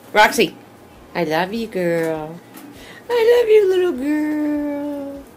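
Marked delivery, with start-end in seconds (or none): 3.1-5.2 high cooing. Acted. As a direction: coo it softly to a pet. Cooing at pet bird.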